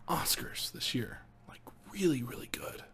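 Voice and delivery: soft whispered wrap-up; whispering. Real, not acted. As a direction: breathe the last words out quietly. Trails off in a whisper.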